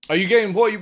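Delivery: normal talking voice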